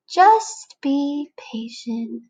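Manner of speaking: very slow, calm friend voice; patient friend. Acted. Friend telling the caterpillar to wait, very slow and calm. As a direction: drag it out soothingly like a patient pal.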